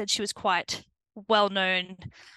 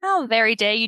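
louder, playful fake indignation; mock outrage; 3 semitones higher, 8 dB louder than usual. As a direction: pretend to be offended, all in jest. Joking about her accent.

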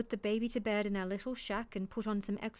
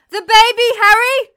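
high, very loud cry of alarm; panicked scream; 12 semitones higher, 19 dB louder than usual. Acted. Mother realizes the baby is unguarded, panicked shout to son. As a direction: scream a desperate warning across a distance.

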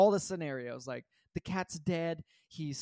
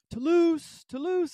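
high, loud call for a lost cat; 12 semitones higher, 8 dB louder, slower than usual. Retold or imitated. Searcher calling a lost cat around the neighborhood, calling out. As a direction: call the name TOULOUSE across the yards. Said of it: "I'm calling his name".

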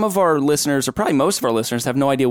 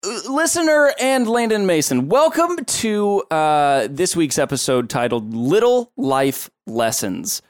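host intro voice; 2 semitones higher, slower than usual. Acted.

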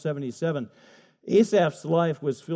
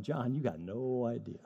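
amused God voice; 5 semitones lower, 10 dB quieter than usual. Acted. God chuckling down at the preacher, soft and amused. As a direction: tease him gently as God.

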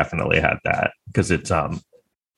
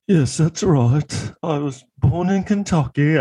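slow, higher mock British accent, drawn out; Johnny Depp's British affect; 8 semitones higher, slower than usual. Depp answering the lawyer about Kentucky, in a slow mock British accent. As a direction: put on a pretentious British accent, slowly. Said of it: "almost like a British accent".

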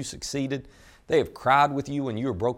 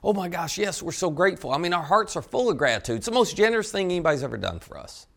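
higher-pitched, effusive mock gushing; emphatic gratitude; 5 semitones higher than usual. Nine healed men asked whether they are thankful, emphatic gratitude. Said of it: "they would have all emphatically said".

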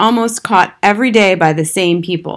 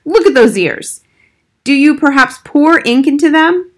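higher, louder mock-stern scolding; fierce nanny; 8 semitones higher, 4 dB louder than usual. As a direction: tease sternly about dirty ears. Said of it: "Old Golly looked exceedingly fierce".

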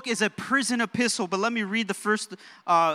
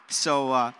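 ordinary talk, nothing marked

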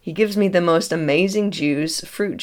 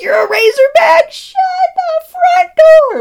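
much higher, louder astonished yell; excited shout; 10 dB louder than usual. Retold or imitated. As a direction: shout it in disbelief. Man stunned by Razorback coincidence.

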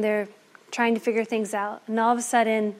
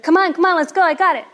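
higher and louder, buzzing to get away; excited hurry; 6 semitones higher, 6 dB louder, faster than usual. Acted. Thief urging his partners to run, higher and louder. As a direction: hurry the others out, thrilled with the loot.

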